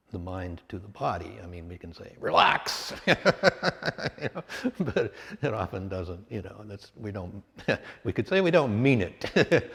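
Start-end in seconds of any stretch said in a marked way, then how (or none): 2.2-2.9 loud, sudden barked order; mock command. 3.1-3.1 real amused laugh breaking into the words; laughing while talking. Real, not acted. Laughing at own joke. 3.2-4.3 loud, higher burst of laughter; real laugh. Real, not acted. Laughs at own mock command. 4.3-9.7 real amused laugh breaking into the words; laughing while talking. Real, not acted. Laughing at own joke.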